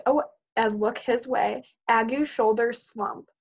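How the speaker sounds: plain reading voice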